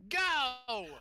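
imitating little girl. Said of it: "and she goes".

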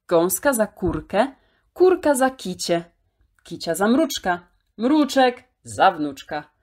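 ordinary delivery

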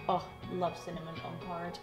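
ordinary talk

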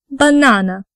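"banana" with the middle syllable NA stressed; stress demonstration. Shown as a demonstration. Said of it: "we will capitalize stressed vowels".

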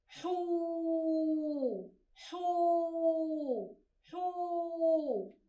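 حو said soft and breathy with a long drawn-out و; long vowel sound. Shown as a demonstration. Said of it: "ومع مد الواو". (حو بصوت خافت مع مدّ الواو طويلاً)

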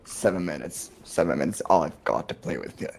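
low villain voice; Wesker impression. Repeating the villain's countdown for laughing players, low menacing voice. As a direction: drop low like a villain toying with someone. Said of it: "Best version of Wesker".

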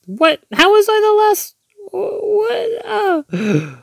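very high, squeaky bewilderment; stunned disbelief. Real, not acted. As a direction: wail it in baffled disbelief. Stunned by losing the game.

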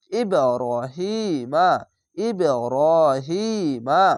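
ইব্রাহিমা stretched out syllable by syllable; slow recitation. Shown as a demonstration. Teacher spells out the word for students, slow drawn recitation. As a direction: draw out ইব্রাহিমা slowly, every letter clear. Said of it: "মিম জবর মা".

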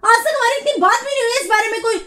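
high, furious scolding; angry scolding. Real, not acted. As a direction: tell him off sharply. Wife scolding husband.

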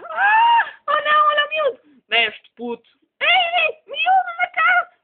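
high, loud panicked shouting; pretend alarm. Pretend parent shouting warnings at the child, high loud alarm. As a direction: yell at the kid to hurry, mock alarm.

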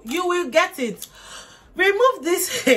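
high, loud, slow declaration; fervent preaching. Real, not acted. Promising viewers a faithful husband, fervent preaching. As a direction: proclaim it like a pastor.

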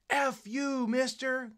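tossing off a bratty retort; mock rude kid. Teacher voicing a defiant student, mock rude. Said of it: "A lot of kids tell me".

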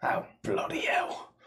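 low, slow, whispery mutter; muttered to self. Acted. Character muttering to himself, low and whispery. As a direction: mutter it softly under your breath. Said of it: "I mutter to myself".

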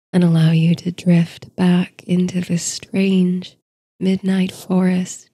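ordinary delivery, nothing marked

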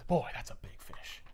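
unvoiced knowing murmur; hushed sly remark about the photo; mock hushed aside. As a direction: breathe it slyly under the voice. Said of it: "he says".

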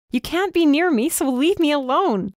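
high and loud, begging him to go; shouting. A narrator's reading. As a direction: shout him away, desperate. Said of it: "I shouted".

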